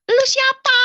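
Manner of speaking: high, loud demand; retold loud challenge. Woman demanding who the voice is, high and loud. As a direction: act out yelling at someone unseen.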